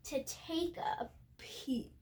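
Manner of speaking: hushed whisper, sneaking. A narrator's reading. Narrator whispering as Maddie sneaks a peek. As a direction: breathe it like a secret.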